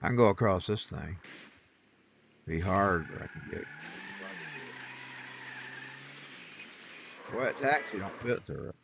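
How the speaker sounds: half to himself, watching the road for kittens; quiet aside. Real, not acted. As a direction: talk under your breath at the wheel. Driving, looking for a kitty.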